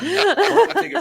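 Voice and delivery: real laughter as he signs off; laughing. Real, not acted. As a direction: still cracking up while going to break.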